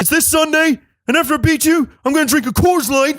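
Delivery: high-pitched mock wrestler voice; wrestler's promo. Wrestler taunting his opponent before Sunday's match, high put-on voice. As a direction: put on a high, boastful fighter's voice.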